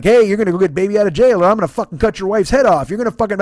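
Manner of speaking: loud, fast thuggish threat; loud mocking villain threat; menacing threat. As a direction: bark it like a killer threatening hostages. Otis threatening hostages, retold.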